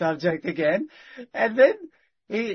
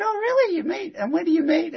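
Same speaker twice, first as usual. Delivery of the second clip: high-pitched questioning, re-enacted; retold interested surprise; 13 semitones higher than usual. Man invited to church meetings, re-enacted surprised high questions. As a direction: lift it high, replaying your own keen interest.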